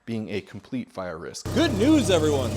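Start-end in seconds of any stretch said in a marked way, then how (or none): 1.5-2.6 announcer voice. Acted. Cheerful announcer catchphrase.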